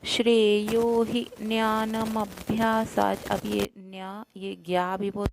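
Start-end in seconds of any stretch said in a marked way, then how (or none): 0.1-3.3 slow recited verse line; slow recitation. Shown as a demonstration. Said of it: "बारहवाँ श्लोक".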